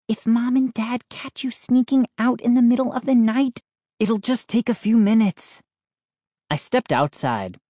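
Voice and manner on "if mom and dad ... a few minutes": sister's voice. A narrator's reading. Sister's worried voice.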